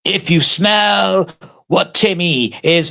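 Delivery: slow, drawn-out catchphrase voice, mocking Timmy; mock wrestler catchphrase. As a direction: stretch it out like a ring promo. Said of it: "Timmy's is like".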